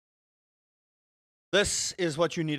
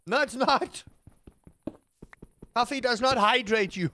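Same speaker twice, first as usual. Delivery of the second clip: firm rebuttal; 5 semitones higher, 4 dB louder than usual. Acted. Firm rebuttal after mock quote.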